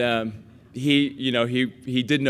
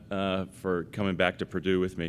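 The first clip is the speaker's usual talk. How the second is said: ordinary talk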